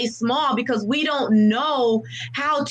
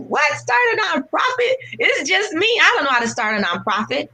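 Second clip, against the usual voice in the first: high, louder shocked cry; shocked disbelief; 5 semitones higher, 4 dB louder than usual. Retold or imitated. Answering the Lord's call to start a nonprofit, stunned. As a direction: blurt it out in disbelief. Incredulous reply to God again.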